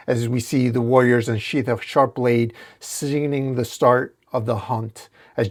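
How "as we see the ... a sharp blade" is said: plain reading voice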